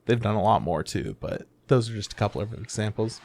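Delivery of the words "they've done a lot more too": normal talking voice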